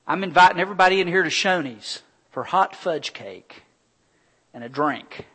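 mild mock booming invitation; cheerful announcement. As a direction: announce the treat to the whole room. Dad announcing to teens.

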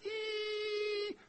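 high thin imitated engine whine. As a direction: mimic a falling engine's whine. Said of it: "you hear this".

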